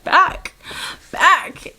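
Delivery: high, loud startled echo; alarmed disbelief. Retold or imitated. Traveler told to come back with the officer, loud disbelief. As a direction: blurt it in mock alarm. Acts out reaction to officer's order.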